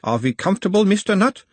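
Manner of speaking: put-on high-pitched analyst with Uberwald accent; mild Uberwald accent. A narrator's reading. Nutt as his own analyst settles the patient, Uberwald accent. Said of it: "his tone of voice changing slightly".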